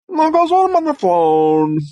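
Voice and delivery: very high, odd voice; high character voice. Shown as a demonstration. Performer sliding back into his high voice. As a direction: keep the strange voice up high.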